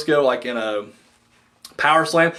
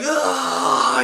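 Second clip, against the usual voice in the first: mock yell; 9 semitones higher than usual. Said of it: "he's yelling".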